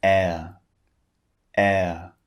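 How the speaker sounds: "Air" as a glide from wide to relaxed, no R at the end; British air vowel. Shown as a demonstration. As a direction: stretch "air" so the mouth moves from wide to relaxed and drop the R. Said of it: "notice it's wide and then it's relaxed".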